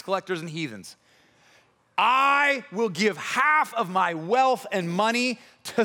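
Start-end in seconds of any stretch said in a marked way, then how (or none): 2.0-5.8 loud, slow and raised in pitch; big, drawn-out vow; public proclamation. Acted. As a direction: declare it grandly to the whole room. Zacchaeus proclaims before the Lord.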